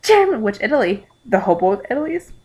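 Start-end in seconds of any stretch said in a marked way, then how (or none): 0.0-0.4 high mock-tearful squeal; hammed-up tearful delight; happy crying. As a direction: squeal the names through happy tears. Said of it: "still crying".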